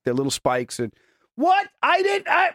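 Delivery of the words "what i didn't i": very high, flustered outburst; mock indignant protest. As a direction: play the startled defensive partner. Voicing a spike in a fight.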